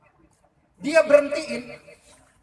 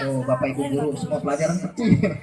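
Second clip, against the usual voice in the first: much lower, laughing punchline; 11 semitones lower than usual. Acted. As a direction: drop the voice for the payoff. Punchline dropped low. (suara turun rendah sambil tertawa)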